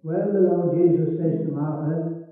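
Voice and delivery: ordinary talk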